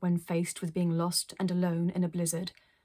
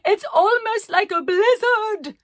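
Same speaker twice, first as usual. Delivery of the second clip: high, loud, slow theatrical yell; yelled over the wind; 13 semitones higher, 12 dB louder, slower than usual. Acted. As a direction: bellow into the storm grandly. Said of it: "she yelled".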